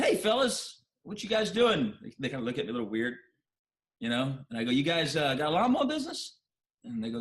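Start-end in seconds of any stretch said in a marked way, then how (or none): 0.0-1.9 high, bright-voiced friendly hail to the boys; friendly call out. Calling to two boys from his car, friendly and high. 4.7-6.4 high, friendly rising question. Retold or imitated. Asking the young mowers about their business, friendly and high.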